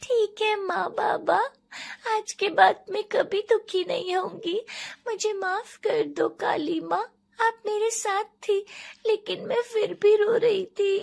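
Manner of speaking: put-on very high, small child's voice; tearful little girl. Daughter promising her parents not to be sad, in a high childlike voice. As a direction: apologise like a child after crying.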